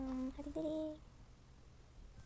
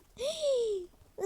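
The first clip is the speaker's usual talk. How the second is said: loud high falling gasp; shocked gasp; 10 semitones higher, 7 dB louder than usual. Pretend. Doll splashed in the pool gasps loudly. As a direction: gasp as the cold water hits.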